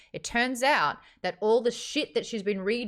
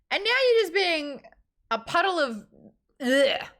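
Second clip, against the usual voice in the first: exasperated disgust; 9 semitones higher, 4 dB louder than usual. Real, not acted. Ranting at heroine, disgusted.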